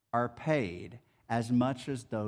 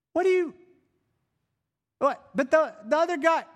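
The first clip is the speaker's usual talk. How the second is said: sputtering, much higher and louder; outraged disbelief; 7 dB louder, faster than usual. Retold or imitated. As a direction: choke on the unfairness in mock shock. Sputtering at the single denarius.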